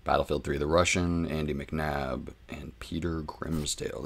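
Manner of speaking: quiet, even narration of a book note; audiobook reading. Acted. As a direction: read the author's note like an audiobook. Reads book's author's note aloud.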